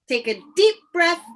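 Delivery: ordinary delivery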